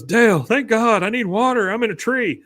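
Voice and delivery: high, pleading stranded voice; desperate Bill. Retold or imitated. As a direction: beg for help like a lost man up a tree.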